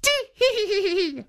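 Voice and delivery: high tittering granny giggle; high tee-hee giggle; old woman giggle. Acted. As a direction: giggle helplessly like a little old lady. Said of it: "laughed the old woman".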